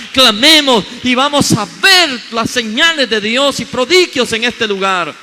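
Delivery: rally the congregation to cry out in faith; fervent preaching. Acted. Preaching builds to a call.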